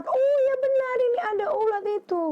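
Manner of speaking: very high, quick storybook exclamation; acted alarm on spotting a pest; alarmed discovery. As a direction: squeal it out high and hurried.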